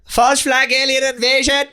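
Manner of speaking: put-on old-time mid-Atlantic announcer voice; transatlantic accent. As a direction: read it like a 1940s newsreel. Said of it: "Can you do it in an, an, an, an, an, a transatlantic accent?".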